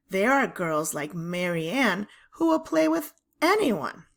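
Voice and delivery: ordinary delivery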